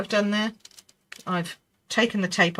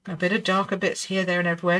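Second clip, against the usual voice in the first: normal talking voice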